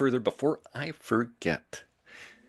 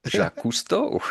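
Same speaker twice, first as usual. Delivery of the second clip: higher, put-on voice for Jacques Cousteau; hammed-up French celebrity voice; mock French voice; 9 semitones higher than usual. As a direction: toss out the name in a silly French character voice. Joking French name in funny voice.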